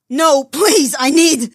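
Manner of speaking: high, loud frantic begging; cracked desperate cry; desperate plea. Acted. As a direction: beg them to stop in panic. Tony fighting for the knife.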